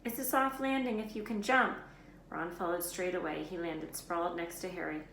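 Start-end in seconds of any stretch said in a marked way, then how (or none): 0.1-1.8 calling up. A narrator's reading. Calling up to friends.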